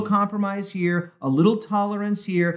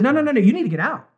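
quick, higher-pitched urging; urgent warning; 5 semitones higher, much faster than usual. Retold or imitated. God urging the people in Sodom, urgent. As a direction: rattle it out as a pressing warning.

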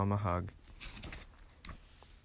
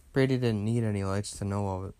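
ordinary delivery, nothing marked

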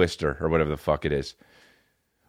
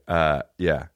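ordinary delivery

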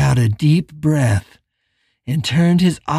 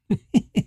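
put-on a high, short child's giggle; childish giggle; 8 semitones higher, 4 dB quieter than usual. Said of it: "the childish voice giggled".

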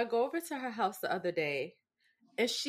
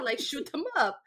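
ordinary talk, nothing marked

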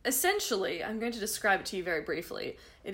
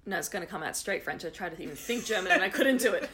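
ordinary talk

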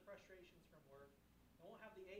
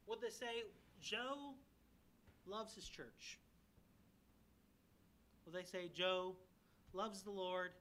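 loud, urgent pulpit preaching; fervent sermon voice; preaching at full force; 2 semitones higher, 7 dB louder than usual. Acted. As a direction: drive it home to the congregation. Pastor preaching with rising force.

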